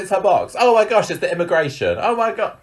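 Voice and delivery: high, loud hammed-up alarm; mock panic. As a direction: gasp it out like a pretend emergency. Mocking his repeated rescue cries.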